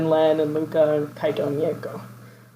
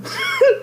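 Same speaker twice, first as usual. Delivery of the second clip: high giggle. Real, not acted. Laughing at the Lola name.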